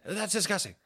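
high-pitched mock outrage; disgusted dad. Dad on the train reacting to the smell, high mock disgust. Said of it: "the dad of the family".

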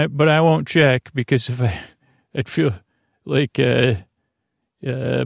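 ordinary talk

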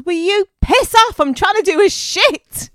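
high, very loud outburst; exasperated shout. Acted. Fox disturbed in the bushes, exasperated high shout. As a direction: yell it at full tilt, fed up.

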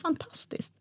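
flat whispered echo. Retold or imitated. Wife echoing her husband's delight in despair. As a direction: echo the word back with no joy at all. She echoes him in despair.

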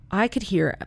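ordinary talk

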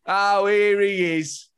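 mock bloke spotting you in the pub; imitating a pub bloke. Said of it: "an unspecific character that he just uses".

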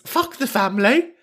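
high, defiant comic verdict; comic defiance. Acted. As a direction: deliver it as cheeky, high-voiced solidarity. Indignant on caller's behalf.

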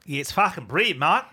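a botched, barely Australian "fucking brilliant"; bad Australian accent. Host doing a bad Australian impression of the judge. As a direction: attempt an Aussie accent and miss. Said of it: "That was horrible. ... not even remotely Australian".

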